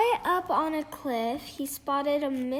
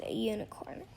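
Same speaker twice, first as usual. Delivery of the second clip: soft unvoiced whisper of the big secret; whispered reveal; 5 semitones lower, 8 dB quieter, faster than usual. A narrator's reading. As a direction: let it out as a hushed secret. Storyteller reveals the twist.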